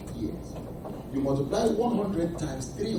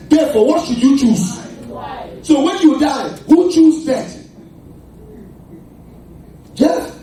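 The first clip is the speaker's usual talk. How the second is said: loud, high shouting sermon; full-force preaching; 6 semitones higher, 9 dB louder than usual. Acted. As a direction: drive it home, louder and louder.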